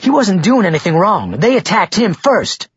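high-pitched indignant protest; livid. A narrator's reading. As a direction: protest hotly on his behalf. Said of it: "Marguerite was livid over this".

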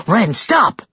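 high, urgent cry; shouted. A narrator's reading. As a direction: call out to halt him. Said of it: "she shouted".

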